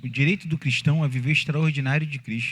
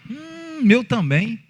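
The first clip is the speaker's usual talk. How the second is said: higher, hammed-up commiserating voice; mock sympathetic chat; 7 semitones higher, slower than usual. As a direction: mimic someone who wallows in the complaint. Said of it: "não é ficar não". (imitando alguém que concorda com a reclamação, em tom agudo e exagerado)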